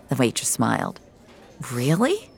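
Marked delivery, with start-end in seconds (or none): 1.7-2.3 doubtful rising question; skeptical. Acted. Customer to waitress offering free breakfast, skeptical. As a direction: question it with mild disbelief. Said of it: "Finch sounded skeptical".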